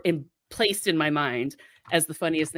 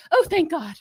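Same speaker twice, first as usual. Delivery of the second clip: relieved mom; 10 semitones higher than usual. Retold or imitated. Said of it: "my mother's response".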